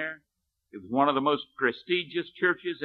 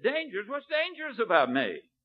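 a retold man's disbelieving echo, pitched higher; incredulous echo; 8 semitones higher than usual. A man stung by William Booth's rejection, in mock incredulity. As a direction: throw the word back in mock disbelief.

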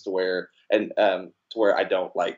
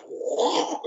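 mock whoa. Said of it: "it just goes".

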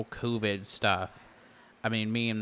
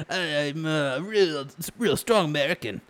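hammed-up American accent, a bit higher; 5 semitones higher, 3 dB louder than usual. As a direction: play a real strong American. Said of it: "Let me do my American accent".

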